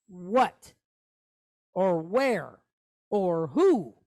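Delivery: What? Or where? Or who? with a lifted, asking tone; questioning tone. Shown as a demonstration. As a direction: ask each word like a real question. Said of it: "if you're questioning something".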